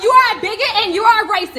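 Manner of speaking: high, loud accusing yell; real angry accusation. Real, not acted. Real heated confrontation on video.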